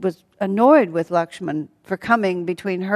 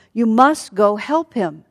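slow, loud, higher-pitched urgent plea; frightened urging; 4 semitones higher, 6 dB louder than usual. Acted. Frightened Sita to Lakshman about her husband, urgent plea. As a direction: press each word with alarm. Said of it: "Sita is terribly frightened".

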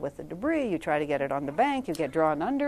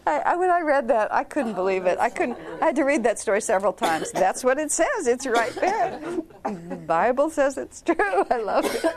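loud, lifted voice full of laughter; laughing, incredulous and delighted; amused disbelief; 5 semitones higher, 5 dB louder than usual. Real, not acted. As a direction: insist on it while still cracking up. Amused disbelief at Bible story.

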